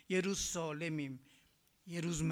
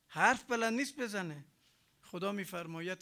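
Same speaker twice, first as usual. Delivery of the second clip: normal talking voice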